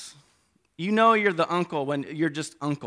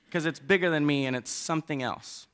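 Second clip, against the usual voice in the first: usual speaking voice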